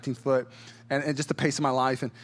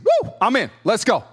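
much higher, loud whoop; eager cheer; 7 semitones higher, 6 dB louder than usual. Retold or imitated. Abraham hyped about the promise, loud high whoop. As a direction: whoop it like you're all in.

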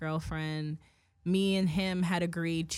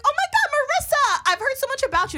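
very high, loud, squealy fake gush; overexcited stranger mimicked in a high squeal; gushing girlfriend; 8 dB louder than usual. Mocking dad's girlfriend greeting her, very high and loud. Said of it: "She was like".

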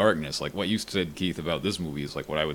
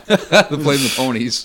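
very slow, louder, laughing; mock posh voice; 8 semitones higher, 10 dB louder, much slower than usual. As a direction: stretch it out like a toff. Continuing posh mockery.

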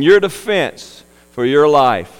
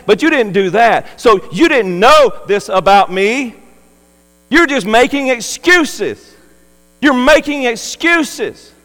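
a stern master, voice raised high, accusing; preaching master's rebuke; 7 semitones higher, 5 dB louder than usual. Acted. Preacher voices the master rebuking the lazy servant, a stern raised rebuke. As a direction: drive the master's rebuke home with rising force.

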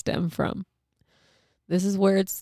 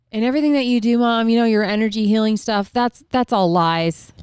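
higher, louder mock yell at Mom; retold kid shouting; 5 semitones higher, 6 dB louder than usual. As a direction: throw the accusation at Mom, raised and loud. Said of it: "continually shouting at me".

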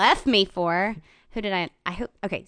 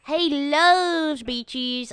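even slower, higher southern dog voice, leaning on "loves"; Cokie the dog; 7 semitones higher, 3 dB louder, much slower than usual. Retold or imitated. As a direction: savor "loves" in a lazy southern dog drawl. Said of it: "And Cokie kinda talks like this".

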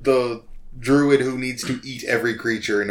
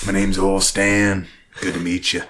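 put-on Old Stan; 2 semitones lower than usual. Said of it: "barbecue sauce commercial redneck voice".

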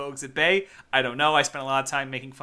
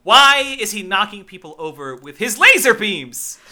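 higher, louder exasperated protest; mock outrage; 6 semitones higher, 9 dB louder than usual. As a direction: demand an explanation in comic outrage. Mock outrage at film's plot.

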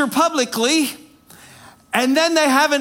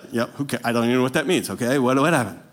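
fast, lower, mumbled confusion; baffled mumble; 12 semitones lower, 3 dB quieter, much faster than usual. Retold or imitated. Preacher mimicking his own past confusion, fast, low mumble. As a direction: rattle it off under your breath, lost. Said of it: "I was like".

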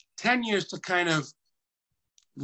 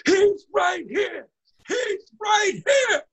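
very high, slow excited shout; Danny Aiello excited; 5 dB louder, slower than usual. Retold or imitated. Danny Aiello shouting into the speakerphone, high and excited. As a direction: yell it out like you've found him. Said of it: "And Danny Aiello goes".

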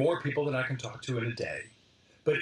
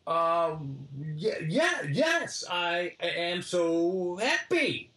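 sheepish then delighted, pitched up; dawning realization; 2 semitones higher, much slower than usual. Retold or imitated. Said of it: "And I went".

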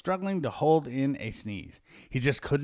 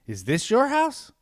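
high-pitched; 10 semitones higher than usual. Acted.

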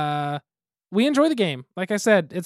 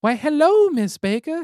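put-on higher, slow little boy greeting; lightly voiced young Billy; boy's voice; 9 semitones higher, 4 dB louder, slower than usual. Shown as a demonstration. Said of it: "weird storytelling voice".

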